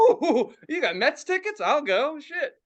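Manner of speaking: high, excited ooh, starting loud; eager interest. Retold or imitated. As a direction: pounce on the offer with a laugh. Acts out eager interest in tickets.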